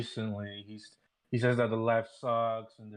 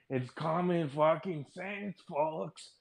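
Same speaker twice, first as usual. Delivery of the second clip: high, slow pompous pundit voice; mocking Caleb; 7 semitones higher, slower than usual. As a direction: ham up a smug commentator, slowly. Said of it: "then Caleb's like".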